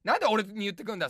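ordinary delivery

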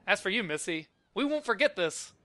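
lightly hammed villain threat, pitch climbing; mock threat. Villain to Missy while leaving, lightly acted threat.

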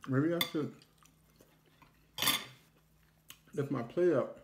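with food in mouth; words muffled by chewing; chewing food while talking. Real, not acted. Seen 0: Bite taken at -2.0; mouth closed and working while speaking.

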